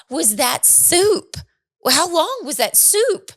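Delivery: high, slow, suspicious questioning; wife quizzing husband about the soup, high and slow. Retold or imitated.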